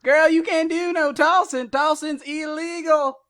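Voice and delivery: silly cop voice. Retold or imitated. Friend imitating the dad cop scolding about tossing. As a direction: squeal it like a fussy sheriff. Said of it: "the other guy goes".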